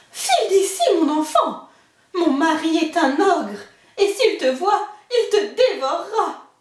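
put-on the giant's wife hurrying the boy away; ogre's wife warning. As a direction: shoo the child away with a nervous chuckle.

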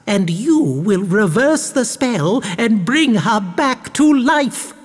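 high, drawn-out scheming baroness, climbing at the end; rising excitement. A narrator's reading. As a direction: build excitedly to the climax. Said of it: "Her voice rose slightly in excitement".

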